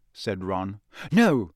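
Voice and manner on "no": curt. A narrator's reading. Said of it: "said Hermione curtly".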